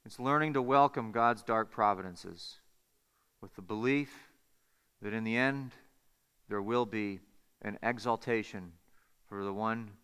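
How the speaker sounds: low, hushed, measured delivery with long pauses; solemn sermon closing. Real, not acted. Preacher closing with a Spurgeon quote on suffering, solemn and hushed. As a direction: bring it down softly, phrase by phrase.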